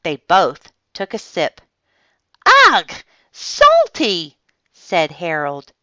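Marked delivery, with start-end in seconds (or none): 2.7-4.4 high, loud yuck reaction; disgusted. A narrator's reading. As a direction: recoil from a nasty taste. Harold reacts to salty sip.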